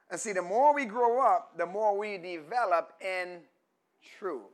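forceful, high-pitched sermon delivery; preaching. Real, not acted. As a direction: drive the point home to the congregation.

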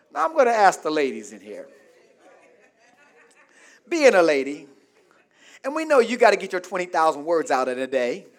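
ordinary talk, nothing marked

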